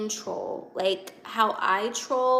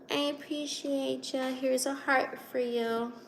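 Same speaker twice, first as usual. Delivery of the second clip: softer, higher cutesy thank-you; sweet soft thanks; 3 semitones higher, 5 dB quieter than usual. Real, not acted. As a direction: coo the thanks sweetly to the viewer. Thanking viewer again, sweeter.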